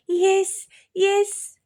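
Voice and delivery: put-on high, loud children's shout; loud kids. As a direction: yell it together like excited kids. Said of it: "అన్నారు ఇద్దరు గట్టిగా".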